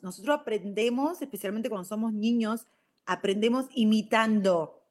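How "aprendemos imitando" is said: usual speaking voice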